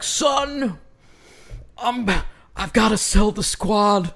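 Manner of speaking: hushed, high, halting movie confession; mock gravitas. Film character tells son he's selling the squad, mock-grave and hushed. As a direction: break the bad news with overdone solemn pauses. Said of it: "comes in with all the gravitas in the world".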